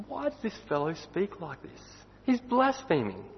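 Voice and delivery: high, slow, shocked voice; scandalised scribes. Acted. Scribes thinking among themselves about Jesus, high and scandalised. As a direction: gasp it out like an outraged scribe. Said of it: "they think to themselves".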